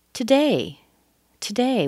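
"today" with DAY high and strong; weak "to", punched "day" in "today"; stress on DAY. Shown as a demonstration. Teacher tests where learners hear it, stress on DAY. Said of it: "you hear it on the day".

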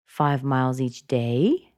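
slow, with "day" swooping up; unfinished upturn on "day"; rising intonation. Shown as a demonstration. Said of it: "she went up in intonation".

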